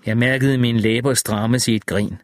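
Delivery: plain reading voice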